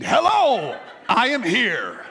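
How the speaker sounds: high, loud grand entrance, laughing; gallant knight. Pretend. Pastor hamming up a knight riding in to his wife. Mock knight entering bedroom.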